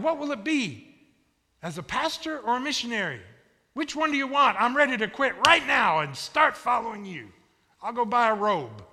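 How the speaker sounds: high, loud, eager questions to God; acted eagerness. Preacher as his younger self offering God everything, eager.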